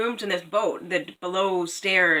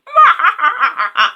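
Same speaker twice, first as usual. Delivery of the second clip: high loud raven cackle; 13 semitones higher, 13 dB louder than usual. A narrator's reading. Raven's final laugh.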